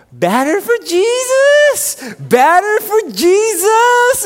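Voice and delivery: high, drawn-out holler across a ballfield; imitated yell. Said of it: "I hear this man yell".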